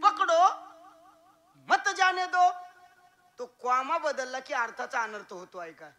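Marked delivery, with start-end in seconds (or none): 0.0-2.5 loud, high पकडो, then a pause before मत जाने दो; pause after पकडो. Shown as a demonstration. Preacher shows the class how the comma splits the sentence, loud with a pause. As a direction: shout पकडो and stop at the comma. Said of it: "कारण तिथं comma आहे".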